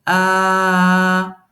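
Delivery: A held long and loud as a model sound; long drawn A. Shown as a demonstration. Teacher modelling the long alif vowel, held and loud. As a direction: sing out the long Arabic A for the class. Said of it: "Donc ça va faire".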